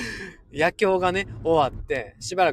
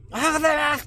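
put-on high-pitched, hearty child's morning greeting; imitating son's greeting; 11 semitones higher, much faster than usual. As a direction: call out good morning like an eager kid. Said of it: "うちの息子ちゃんはね". (息子を真似た元気な挨拶)

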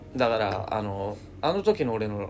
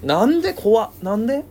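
high, loud why; baffled; 10 semitones higher, 7 dB louder than usual. Real, not acted. Partner blames someone absurdly, loud disbelief.

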